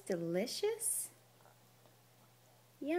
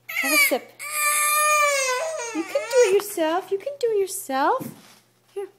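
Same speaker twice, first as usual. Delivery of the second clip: much higher, louder sing-song coaxing; 10 dB louder than usual. Real, not acted. Mother coaxing her baby to drink, high loud baby talk. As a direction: coax him up high and bright.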